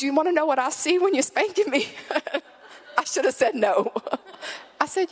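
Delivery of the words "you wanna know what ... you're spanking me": put-on high-pitched kid challenge; pointed child voice, pitched up; little boy. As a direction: imitate a four-year-old putting mom on the spot. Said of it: "He's probably four".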